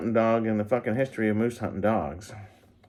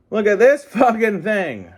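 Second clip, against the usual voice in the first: high, loud burst of excitement; excited shout; 10 semitones higher, 7 dB louder than usual. Real, not acted. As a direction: shout it out, thrilled with the toy. Repeats exclamation louder.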